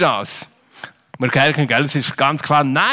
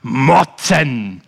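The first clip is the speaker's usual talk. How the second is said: mock grumble; 4 semitones lower, 3 dB louder than usual.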